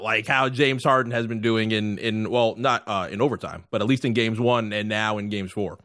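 ordinary delivery

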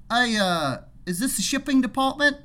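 imitated Aussie trucker, pitched high; Australian accent. Trucker asking a worker for shipping, mock Australian accent. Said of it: "making fun of his accent".

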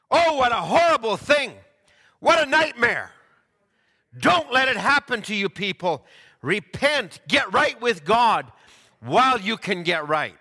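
loud, high impassioned warning; preaching shout. Retold or imitated. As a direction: cry out the warning to repent. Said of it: "the prophet's voice just goes, he goes up into".

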